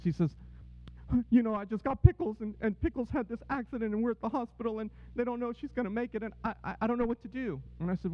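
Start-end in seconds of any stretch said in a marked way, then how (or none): 1.3-7.6 higher-pitched, distressed retelling; retold tearful phone call. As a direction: imitate an upset man calling his boss, voice raised in pitch. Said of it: "and he's crying".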